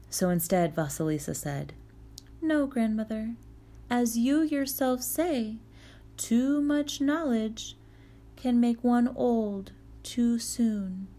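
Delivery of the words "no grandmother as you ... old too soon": put-on young girl voice. Vasilisa answering the witch.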